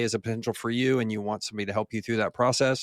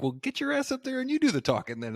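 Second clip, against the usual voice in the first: high-pitched mock exasperation; exasperated retort. Dad to his critical family, mock exasperated retort. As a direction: retort in a high, put-upon voice.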